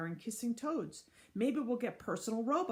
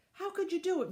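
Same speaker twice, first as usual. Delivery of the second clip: higher accusing cry; shouted; 10 semitones higher, 4 dB louder than usual. Acted. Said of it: "one of the parents shouted".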